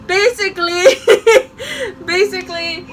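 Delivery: high giggly laughter over words; real laughter while telling; laughing. Real, not acted. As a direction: laugh through it.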